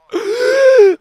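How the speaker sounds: high, loud indignant gasp; outraged gasp. Retold or imitated. Kid finding cherries gone, outraged gasp. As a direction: suck in a big shocked breath.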